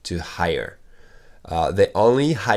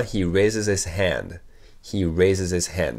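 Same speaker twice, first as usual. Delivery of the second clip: slow model sentence; slower than usual. Shown as a demonstration. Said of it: "repeated model sentence".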